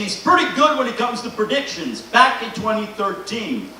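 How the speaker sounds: normal talking voice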